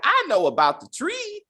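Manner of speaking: put-on high-pitched little boy voice; mock small-child voice; [name]'s kid voice. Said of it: "That voice is okay because he's a kid".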